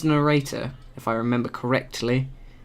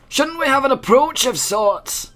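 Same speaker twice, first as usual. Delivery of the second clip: higher put-on companion voice; 10 semitones higher than usual.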